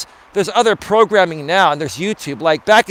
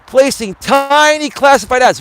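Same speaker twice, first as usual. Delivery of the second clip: high-pitched, stretched TINY; over-emphasize like an infomercial pitchman; Don Lapre emphasis; 6 semitones higher than usual. Retold or imitated. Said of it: "talking slow and emphasizing those words".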